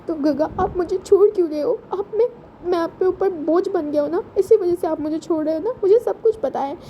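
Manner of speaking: put-on a child's wounded accusation; a boy sure he is a burden; lightly voiced hurt child; hurt little boy. Ayuan accuses Wing of leaving.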